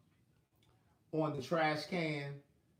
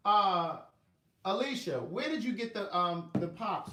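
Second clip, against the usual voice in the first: calling out; 5 semitones higher, 8 dB louder, slower than usual. Real, not acted. Calling to someone off mic.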